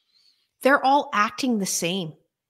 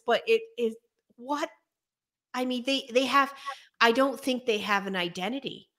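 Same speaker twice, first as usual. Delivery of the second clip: normal talking voice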